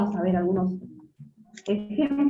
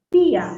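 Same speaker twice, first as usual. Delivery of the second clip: Tía said louder, stressing the I; 2 semitones higher, 7 dB louder than usual. Shown as a demonstration. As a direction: lean hard on the I in Tía. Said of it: "¿Dónde cae la mayor fuerza de voz?". ("Tía" más fuerte, con el golpe en la I)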